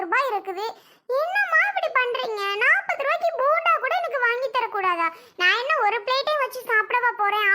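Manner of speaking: put-on high-pitched pleading little girl; whiny child. Little girl pestering her mother for a bonda, high whiny plea. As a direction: whine at mum like a kid denied a treat.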